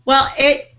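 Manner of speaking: ordinary talk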